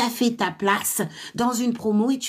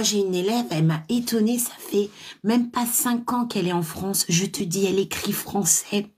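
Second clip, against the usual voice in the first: normal talking voice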